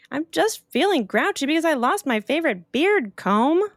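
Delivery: high, grumpy goat voice; a grumpy goat griping; grouchy goat. Acted. Mountain goat grumbling to Opal about his comb. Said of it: "The mountain goat said".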